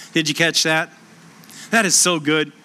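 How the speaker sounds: ordinary talk, nothing marked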